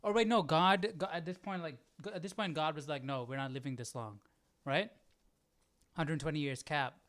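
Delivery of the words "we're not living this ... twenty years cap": ordinary talk, nothing marked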